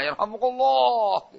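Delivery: high, long, softened echo of a crowd; menirukan seruan menggema. Retold or imitated. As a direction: imitate a distant crowd's drawn-out chant. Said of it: "Begitu, menggema". (menirukan gema seruan yang panjang dan pelan)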